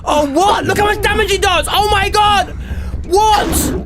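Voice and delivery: high, loud, fast disbelief; excited shouting. Real, not acted. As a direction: yell in shocked excitement. Ladybug damage shocks him.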